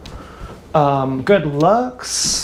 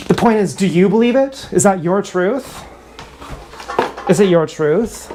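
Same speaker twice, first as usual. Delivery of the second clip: ordinary delivery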